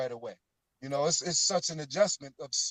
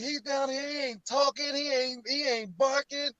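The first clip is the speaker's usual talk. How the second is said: retold complaint; 7 semitones higher than usual. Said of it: "I'm like".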